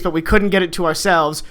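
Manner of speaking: normal talking voice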